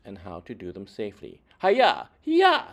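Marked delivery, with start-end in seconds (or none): 1.6-2.6 sharp high kiai yells, much louder; martial arts shouts. Acted. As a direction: shout each strike like a fighter throwing a blow. Stuntmen teach martial arts moves.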